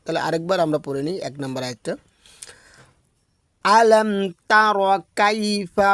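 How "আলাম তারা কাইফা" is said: slow, lifted, chanted verse; Quran recitation. Shown as a demonstration. Teacher recites the first verse for students. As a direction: recite slowly with held endings.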